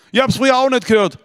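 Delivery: high, loud defensive protest; insist, offended; father protesting he shows his love; indignant father. Retold or imitated.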